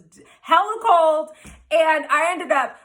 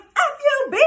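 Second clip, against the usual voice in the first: going off; 11 semitones higher than usual. Retold or imitated. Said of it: "going off".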